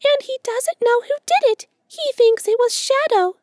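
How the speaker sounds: very high squeaky voice with a weak grin; sheepish squirrel. A narrator's reading. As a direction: squeak it with a sly, feeble grin. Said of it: "with a weak little grin".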